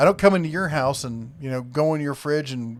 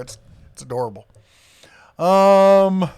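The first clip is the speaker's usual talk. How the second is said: normal talking voice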